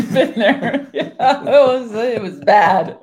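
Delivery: laughing hard, high and loud through the words. Real, not acted. Wife laughing at their own eviction story, loud and high. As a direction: laugh it out, remembering a rough time.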